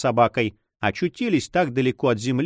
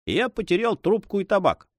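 mildly annoyed, higher pitch; vexed reply; 8 semitones higher than usual. A narrator's reading. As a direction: answer with a touch of vexation. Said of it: "с досадой ответил флебустьер". (с лёгкой досадой)

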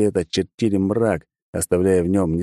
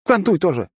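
shouted order; 11 semitones higher than usual. Acted. Girl ordering narrator.

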